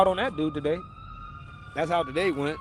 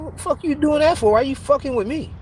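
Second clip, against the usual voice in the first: louder, higher angry retort; mock angry retort; 7 semitones higher, 6 dB louder than usual. Retelling arguing with the landlord over rent, angry and loud. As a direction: snap back at him loudly.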